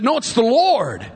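high, strained disciple's call; acted excited cry.

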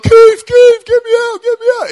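high, loud panicked cry for help; trapped in the cesspit, yelling to Keith; desperate calling. Retold or imitated. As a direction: scream for your mate from the pit.

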